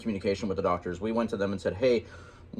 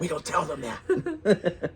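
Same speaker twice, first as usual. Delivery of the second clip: laughing; 6 semitones higher, much slower than usual. Real, not acted. Joking conspiratorial aside.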